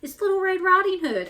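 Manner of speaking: wolf's sweet voice. Acted. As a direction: sweeten it up to fool her. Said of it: "answered the wolf in a sweet voice".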